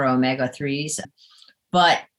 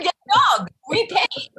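retold husband; 14 semitones higher than usual. Retells husband's words amid laughter.

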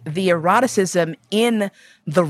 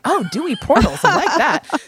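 mock swooning; 9 semitones higher than usual. Mock-admiring the Victorian phrasing.

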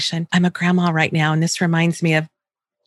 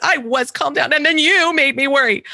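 amused whiny quote; 6 semitones higher than usual. Retold or imitated. Mother replaying daughter's favorite complaint, amused. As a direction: relish the silly complaint. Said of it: "one of my favorites".

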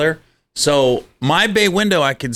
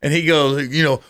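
ordinary talk, nothing marked

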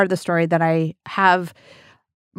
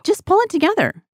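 pushy advice; 10 semitones higher than usual. Retold or imitated. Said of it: "a well-intentioned person that's like".

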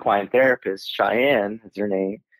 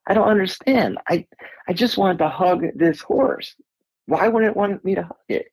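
confused; 10 semitones higher than usual. Retold or imitated. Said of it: "I'm like".

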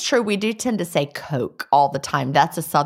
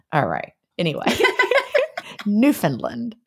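ordinary talk

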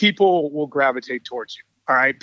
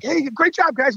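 excited cheer; 9 semitones higher than usual. Retold or imitated. Cheering players after a run.

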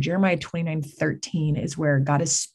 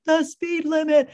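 much higher, slow, drawn-out reveal; mock-dramatic punchline; 11 semitones higher, slower than usual. Husband revealing the driver's speed to the office ladies, high slow mock drama. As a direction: land it like a grand reveal.

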